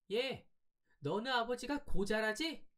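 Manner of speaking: put-on very high-pitched girl's voice; high, drawn-out jeer meant for his ears only; taunting girl. A narrator's reading. Girl jeering behind the boy's back, taunting. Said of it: "나에게만 들릴 듯 말 듯한 음성으로".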